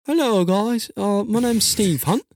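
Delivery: high, slow mock British gentleman; Steve Hunt. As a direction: draw it out in a put-on British voice. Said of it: "I do have a Steve Hunt impersonation".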